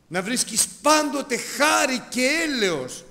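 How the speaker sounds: loud, high, slow benediction; fervent blessing. Acted. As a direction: lift the blessing over the congregation. Preaching blessing. (δυνατή, αργή ευλογία)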